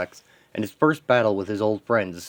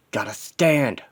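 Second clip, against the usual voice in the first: determined effort; 3 semitones higher, slower than usual. Acted. Injured hero willing himself upright, determined. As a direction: force out "stand" with a surge of effort.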